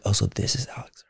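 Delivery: hushed whisper. Retold or imitated. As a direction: whisper it like a secret. Whispered aside, retold thought.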